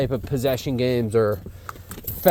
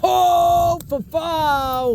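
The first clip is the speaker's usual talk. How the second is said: excited high shout; 14 semitones higher, much slower than usual. Real, not acted. Trainer crying out happily as the dog runs up. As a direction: call out with delight as he arrives.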